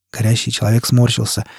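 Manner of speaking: ordinary delivery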